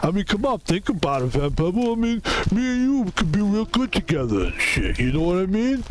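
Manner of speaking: pally, coaxing Rocky Balboa voice; Rocky impression. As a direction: talk a buddy round as Rocky. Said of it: "we have to do your fucking accent".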